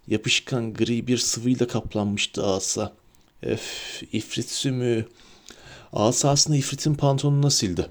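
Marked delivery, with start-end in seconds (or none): 3.4-5.1 breathy, much softer groan of disgust; Harry grossed out by the snot on his wand. A narrator's reading. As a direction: recoil from the slime.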